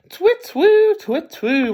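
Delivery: very high, loud hooting owl. Acted. Owl in the garden at night, high loud hoot.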